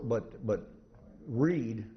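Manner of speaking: usual speaking voice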